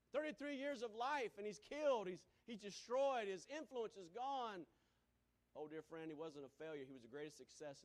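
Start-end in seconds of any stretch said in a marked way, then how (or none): 0.1-4.6 loud, high, forceful declamation; preaching at full force. Acted. Preacher voicing the world calling Jesus a failure, full-force preaching. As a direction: hammer out the verdict at a high pitch.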